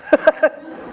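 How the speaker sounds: breathy high laugh at herself; quick unvoiced laugh; amused burst of laughter. Real, not acted. Laughing at her own impression.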